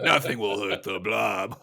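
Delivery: slow, put-on monster boast; the Blob. Co-host quoting the Blob from X-Men, slow mock boast. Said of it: "It's kinda like the Blob".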